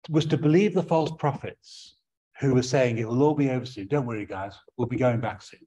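normal talking voice

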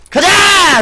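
loud, high cheer; excited shout. Real, not acted. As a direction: yell it out in triumph. Cheering during a kill streak.

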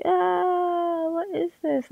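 high, crying-edged "ah, what is this"; a pained, squeaky complaint; dismayed at own voice. Retold or imitated. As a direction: recoil at the sound of your own recording. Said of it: "I'm just like".

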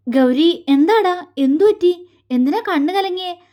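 flustered alarm. A narrator's reading. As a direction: plead with her, worried she is crying. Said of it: "അവന് വെപ്രാളം തോന്നി".

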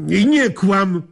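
father snaps at daughter to stop lying; angry. Acted. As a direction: cut her off, high and loud.

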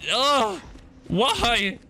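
high-pitched panicked disbelief; a frightened player, voice jumping up in panic; real panic. Real, not acted. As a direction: squeal it out as the stalker closes in. Mister X appears, panic.

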